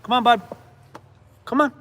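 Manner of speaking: high, loud call to the dog. Real, not acted. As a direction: call him in, up and bright. Calling dog to come.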